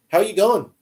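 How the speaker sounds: put-on Australian accent. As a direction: greet like an Aussie, accent put on. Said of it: "any other Australian slang".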